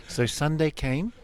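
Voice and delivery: ordinary talk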